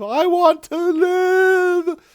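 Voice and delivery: very slow, very high drawn-out wail; desperate wail. Retold or imitated. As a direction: wail it, stretching every word. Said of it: "Tuvix was begging for his life".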